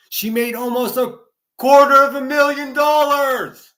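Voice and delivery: high, loud, outraged yell about the money; furious shout at the sum. Real, not acted. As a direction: belt it out in disbelief. Outraged rant about her money.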